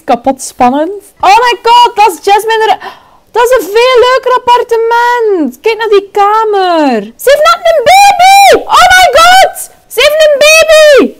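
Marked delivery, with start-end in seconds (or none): 1.2-11.1 very high, loud excited squeal; squealing excitement. Real, not acted. Excited at seeing baby.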